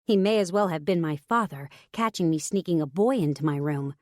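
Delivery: ordinary delivery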